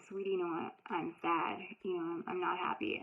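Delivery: put-on soft, gentle admission to a child; tender to her child. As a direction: speak softly, as if to a four-year-old. Confessing sadness to little daughter.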